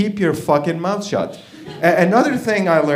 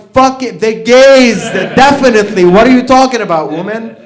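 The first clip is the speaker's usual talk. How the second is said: loud, high, stubborn insistence; hammed-up yelling to win a quarrel; emphatic mock shout; 7 semitones higher, 11 dB louder than usual. Husband insists to wife on his culprit, mock shouting.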